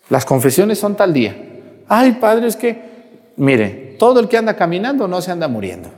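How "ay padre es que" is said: higher mock plea; a hint of the pushy churchgoer; pleading parishioner. To the priest, a lightly voiced higher pleading parishioner.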